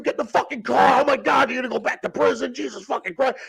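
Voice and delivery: high, agitated dad rant, imitated; exasperated yelling father. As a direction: scold frantically as the panicked father.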